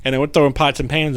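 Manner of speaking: ordinary talk, nothing marked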